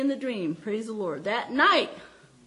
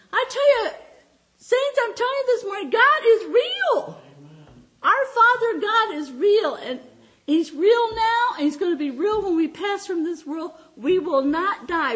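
full-force preaching; 8 semitones higher, 6 dB louder than usual. Acted. Pastor preaching to the saints, God is real, high and loud. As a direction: proclaim it to the church at full force.

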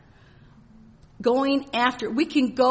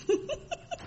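high joyful laugh; 7 dB quieter than usual. Real, not acted. Overjoyed laugh mid-testimony.

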